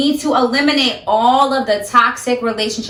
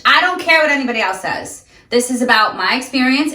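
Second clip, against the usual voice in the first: ordinary delivery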